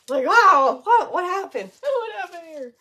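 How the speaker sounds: high, loud mock dismay; mock shock. Retelling the sealer mishap to viewers, high mock shock. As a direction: act out gaping at the ruined piece.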